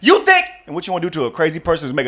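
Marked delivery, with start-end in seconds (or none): 0.0-0.5 high, loud angry shouting; angry mother yelling, much higher; furious mom yell. Retold or imitated. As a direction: storm down the bleachers yelling. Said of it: "she come down the bleachers yelling".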